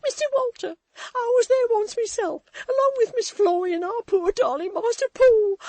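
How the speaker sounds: high, loud, crying desperate plea; frantic tearful pleading. A narrator's reading. Maid begging Walter to help find the nurse, crying. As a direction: beg through sobs, barely holding together.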